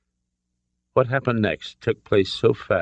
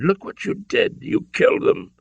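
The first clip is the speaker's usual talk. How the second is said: high, voiceless gasp of horror; shocked and horrified; 14 semitones higher than usual. A narrator's reading. Father sees his son's killings, horrified gasp. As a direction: let the horror take your voice away. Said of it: "He was shocked and horrified".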